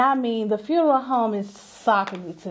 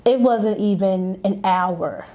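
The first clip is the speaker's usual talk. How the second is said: normal talking voice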